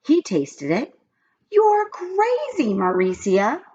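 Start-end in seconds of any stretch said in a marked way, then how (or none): 1.5-3.6 high, slow drawn-out tease; lightly voiced boy teasing. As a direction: stretch it out playfully. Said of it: "he said".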